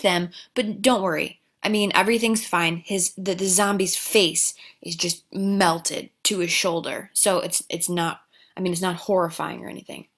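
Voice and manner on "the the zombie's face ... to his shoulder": ordinary delivery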